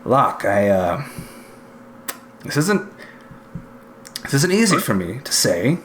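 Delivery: halting, grudging praise with long pauses; reluctant stern inquisitor. Acted. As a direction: force the compliment out bit by bit.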